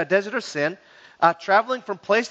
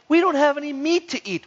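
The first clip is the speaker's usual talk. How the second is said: higher-pitched mock complaint; complaining; 7 semitones higher than usual. Israelites complaining to God about manna, high mock griping. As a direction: whine about the food, pitched up. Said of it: "where they're complaining".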